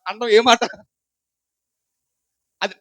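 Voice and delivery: ordinary talk, nothing marked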